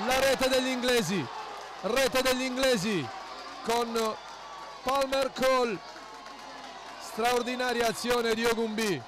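rising, rapid goal commentary; excited goal call. Real, not acted.

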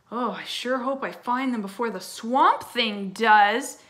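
loud, slow, pointed announcement; loudly, for eavesdroppers. A narrator's reading. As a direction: say it loudly so the hiders overhear. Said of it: "he added loudly".

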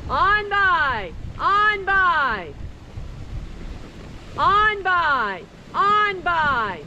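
high sing-song command to the dogs; dog sled musher. Real, not acted. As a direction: call "on by" up high and drawn out. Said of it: "we're gonna yell "on by".

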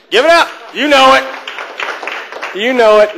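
rally the crowd at full volume; rousing call for applause. Real, not acted. Comic asking women to applaud themselves, rousing shout.

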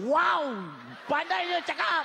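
put-on high, amazed child voices; awed kindergarten kids. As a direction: gush in a high-pitched kiddie voice. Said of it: "Dia orang macam".

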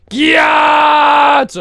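long, high, held shout; loud drawn-out whoop; catchphrase yell. Pretend. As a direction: holler one word and hold it.

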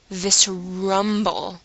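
normal talking voice

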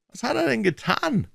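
high-pitched impatient question. A narrator's reading. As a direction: press him for the answer. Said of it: "Stetland wurde ungeduldig".

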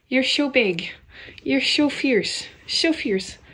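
cooing praise to her kittens. Real, not acted. Baby talk to kittens.

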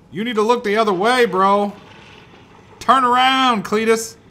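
high, loud command; urgent loud order. Pretend. Player ordering Cletus to turn around, loud insistent shout. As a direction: bellow orders at someone who won't listen.

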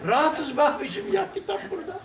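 laughing, high youth's voice; rahatız dediğini gülerek söyle; laughing reply. Retold or imitated. Youth answering while laughing. (gülerek, tiz sesle genç)

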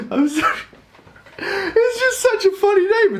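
very high, squeaky giggling apology; laughing. Real, not acted. Apologising for finding the name funny, laughing high. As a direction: giggle helplessly through the words.

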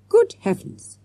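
a delighted jolt of recognition; surprised cry. A narrator's reading. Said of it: "cried Mister Jones".

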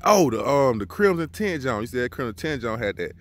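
normal talking voice

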